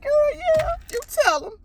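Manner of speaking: high, tearful cheering-on, re-enacted; mock crying. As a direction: cheer her on through pretend tears. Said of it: "I cried".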